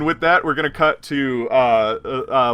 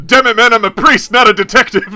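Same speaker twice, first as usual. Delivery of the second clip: louder, higher, laughing protest; exasperated shout; 9 semitones higher, 11 dB louder than usual. Acted. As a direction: bellow it through laughter. Player laughing outburst as priest.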